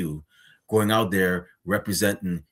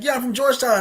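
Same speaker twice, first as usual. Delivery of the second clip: excited; 13 semitones higher, 4 dB louder, faster than usual. Retold or imitated. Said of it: "I'm like".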